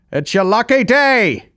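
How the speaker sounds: put-on high, loud English accent, drawn out; old English guy. Pulling up at an orphanage to pick one kid, old English guy. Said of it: "an old English guy".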